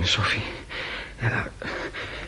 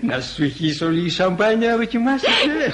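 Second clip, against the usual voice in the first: high, laughing teasing; tipsy giggling; 9 semitones higher than usual. Acted. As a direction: giggle while pouring the champagne. Drunk teasing with champagne.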